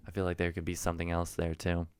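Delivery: normal talking voice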